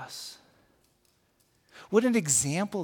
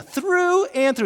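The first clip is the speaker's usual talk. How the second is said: through and through slow and much higher; emphatic, much higher; 12 semitones higher, 7 dB louder, slower than usual. Shown as a demonstration. Pastor savouring the phrase, high and drawn out. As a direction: sing out through and through. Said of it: "I love that idea".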